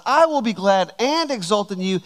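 "and" punched between "glad" and "exult"; emphasis on AND. Shown as a demonstration. Preacher showing what David wanted to emphasize, stressed "and". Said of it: "David wanted to emphasize".

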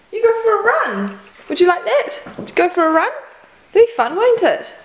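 high coaxing voice to a pet; pet talk. Real, not acted. Coaxing the cat to run.

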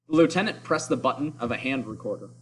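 ordinary delivery, nothing marked

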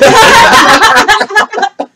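loud helpless laughing; can't stop laughing; high burst of laughter. Real, not acted. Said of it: "I almost wet my pants laughing".

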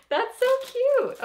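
high, slow squeal with a laugh; delighted squeal. Real, not acted. Vlogger gushing over her friend's gift, squealing with a laugh. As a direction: gush over it with a giggle.

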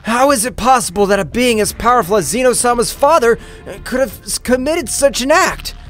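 mildly anxious angel voice, pitch lifted; worried. A narrator's reading. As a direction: ask in worried disbelief, rising high at the end. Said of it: "spoke in a worried voice".

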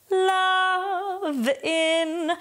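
"Love in" sung high with the V joined; high sung "Love in" with a linked V. Shown as a demonstration. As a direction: carry the V of "Love" into "in". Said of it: "and then we have V".

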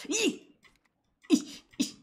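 sharp high squeals. Real, not acted. Streamer reacting to a startling face, high squeals. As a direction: squeal in shock.

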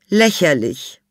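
high, put-on elderly lady, dry; old lady Miss Sophie. A narrator's reading. Old Miss Sophie answers the inspector, high and dry. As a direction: play the haughty old lady. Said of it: "erwiderte Miss Sophie".

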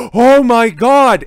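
loud high sarcastic gasp then Oh my God; mock gasp. Real, not acted. Mocking the article's reveal with fake shock. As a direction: fake astonishment, big and slow.

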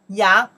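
yang pronounced loudly as one syllable; model pronunciation. Shown as a demonstration. Teacher shows how the inside part is pronounced, loud yang. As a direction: project yang as a clear model. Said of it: "We pronounce the".

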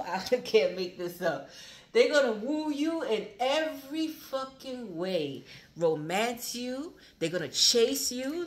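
slow, high, loud, laughing delight; gleeful. Real, not acted. Reader gloating over romance in the cards, slow and high. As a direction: savour each word with glee.

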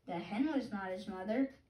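ordinary delivery, nothing marked

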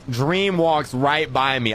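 usual speaking voice

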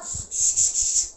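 long hissed shush; drawn-out "shh". Acted. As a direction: hush a noisy household. Hushes the uproar.

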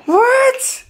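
very high, squeaky "what?". Real, not acted. Excited baby talk to little child.

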